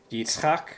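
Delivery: Yitzchak as yits-KHAK, rising at the end; stress on last syllable. Shown as a demonstration. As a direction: lift the final syllable of Yitzchak. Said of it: "The accent is on the last syllable".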